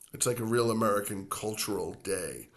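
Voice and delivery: ordinary delivery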